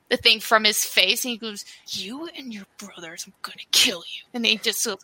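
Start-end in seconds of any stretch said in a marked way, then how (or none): 1.9-4.2 whispered threat. Acted. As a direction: threaten under the breath, very softly. Mouse threatens the blind mouse.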